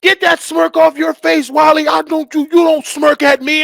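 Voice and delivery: loud, high barking sergeant; drill sergeant yelling. Retold or imitated. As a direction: yell it like a drill sergeant in his face. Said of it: "they would yell at me".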